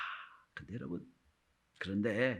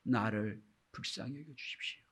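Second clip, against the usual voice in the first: one-word prayer; 4 semitones lower, 10 dB quieter than usual. Retold or imitated. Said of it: "딱 한마디예요".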